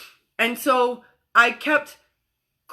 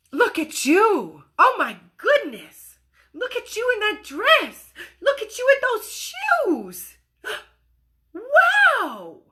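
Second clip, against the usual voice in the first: higher and higher, slow, delighted praise; gushing adult; 9 semitones higher, slower than usual. Acted. Grown-up gushing over the little girl in the dress. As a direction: gush over her like a proud parent. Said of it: "You'd say".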